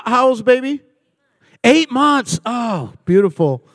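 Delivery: ordinary delivery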